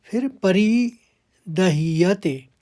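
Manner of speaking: परिदह्यते said slowly, ह and य joined; syllable by syllable. Shown as a demonstration. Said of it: "ह और य मिले हुए हैं". (परिदह्यते धीरे धीरे)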